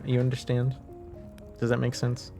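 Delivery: ordinary delivery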